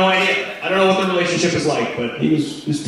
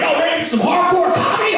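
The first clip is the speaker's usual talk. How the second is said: hyped stage voice. Retold or imitated. Comic opening his set, mock hyped showman. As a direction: fire up the crowd like a hype man. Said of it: "I was like".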